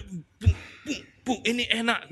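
a hint of a madman enjoying it; mock deluded man. Imitates man hitting himself, enjoying it.

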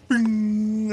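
high, sustained "bing"; high ringing mimicry; imitated streetlight noise. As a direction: ring out like a light switching on. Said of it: "and the light right outside the house".

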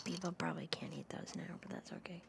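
ASMR whisper. Acted. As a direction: whisper it close to the mic. Whispered aside.

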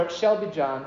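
"shall" stressed as another rejected wording. Shown as a demonstration. Preacher offering a second rejected wording, stress on "shall". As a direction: lean on "shall" in "It shall be John". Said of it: "he doesn't say".

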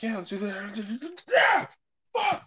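very high, squeaky, slow then yelled "Yeah, fuck"; eager young boy. Retold or imitated. Imitating a seventh grader's excitement, squeaky high voice. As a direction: voice a hyped little boy cracking high. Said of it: "So just like".

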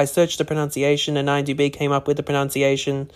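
ordinary delivery

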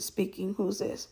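man on the phone asking who is calling, softly in a low voice; imitating a low male voice. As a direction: answer softly like a deep-voiced stranger. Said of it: "his voice is low".

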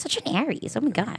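lightly put-on Valley Girl. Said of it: "in the Valley Girl voice".

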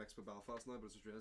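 ordinary talk, nothing marked

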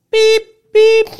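very high, loud beeping; mock alarm beep. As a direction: imitate the shrill store alarm. Imitating store alarm.

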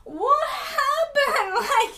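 high, loud, drawn-out wail of disbelief; mock-stunned cry; shocked bewilderment. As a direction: stretch it out in hammed-up alarm. Embroidery guy's startled reply, acted.

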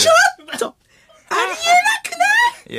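piercing stretched screech; 川北麻由子. Acted. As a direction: cry out in very high mock disbelief. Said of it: "川北麻由子さん". (甲高い絶叫の川北ものまね)

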